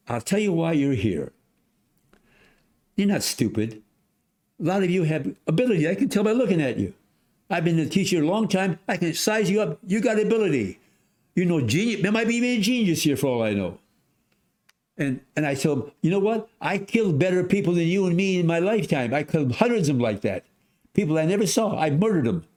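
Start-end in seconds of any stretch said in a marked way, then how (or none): 0.1-13.8 higher and quicker, emphatic teacher address; teacher's pep talk. Retold or imitated. Teacher telling the bad boys they have ability, higher and emphatic. Teacher lecturing tough class. 16.0-22.4 high, pressing and emphatic; blunt teacher confession. Retold or imitated. Teacher shocking the class about the war, high and emphatic.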